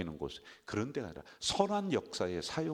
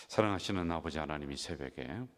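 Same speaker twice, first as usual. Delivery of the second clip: prayer; 5 semitones lower than usual. Acted. Closing prayer.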